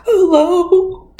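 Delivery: high, loud sobbed greeting; mock crying. Bride answers her ringing phone mid-cry, mock crying. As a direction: wail it as if bawling on the phone. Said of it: "she starts crying".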